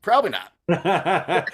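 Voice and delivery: loud, high, drawn out into laughter; laughing echo. Real, not acted. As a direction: repeat it slowly, bursting into a laugh.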